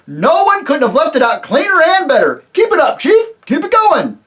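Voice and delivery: high, loud, eager agreeing roar; the other voices roar their eager agreement; roaring chorus. Acted. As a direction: shout it like a crowd of cheering yokels. Other voices cheer the chief.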